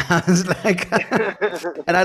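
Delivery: bursts into high laughter mid-sentence; real laughter while telling the story; laughing. Real, not acted. Recalling the toast and laughing.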